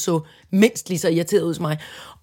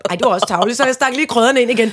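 ordinary talk, nothing marked

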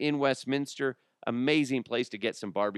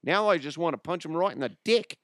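higher-pitched mock Aussie voice; Australian accent; 6 semitones higher than usual. Offended Australian listener turning on the host, mock Aussie accent. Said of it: "All you Aussies that are like".

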